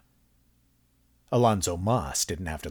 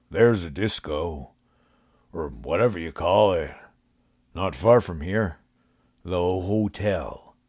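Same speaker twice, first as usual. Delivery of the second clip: homeless man voice. A narrator's reading. Homeless man giving directions.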